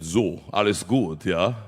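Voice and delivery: slow, low German technician's voice; mild German accent, drawn out. Retold or imitated. Technician checks on the singer, in a low German accent. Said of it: "the German technician came across and he said".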